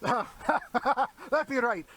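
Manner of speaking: laughing. Real, not acted. Host laughs at the joke.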